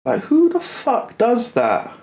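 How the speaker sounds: high, slow, incredulous rant; high-pitched, drawn-out outrage; indignant outrage. Real, not acted. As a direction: drag it out in disbelief. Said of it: "I was so angry".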